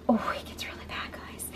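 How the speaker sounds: hushed dramatic whisper to viewers. Real, not acted. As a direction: lean in and whisper the bad news. Teasing viewers, dropping to whisper.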